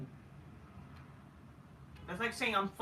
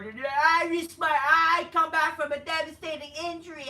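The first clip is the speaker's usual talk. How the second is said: hammed-up mock sob; 10 semitones higher, 10 dB louder than usual. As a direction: overact the tearful comeback speech. Mocking an injury-comeback promo.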